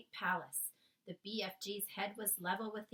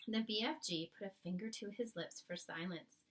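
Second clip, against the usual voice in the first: plain reading voice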